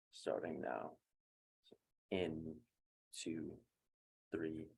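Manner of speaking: soft, slow counting with long pauses; calm, hushed meditation guide; guided breathing. Acted. Meditation instructor counting a class through box breathing, soft and slow.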